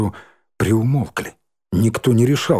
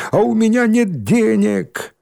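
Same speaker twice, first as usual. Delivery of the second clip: cry out; 11 semitones higher than usual. A narrator's reading. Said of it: "крик Васильева".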